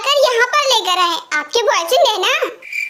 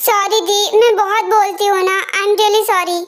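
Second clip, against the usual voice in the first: normal talking voice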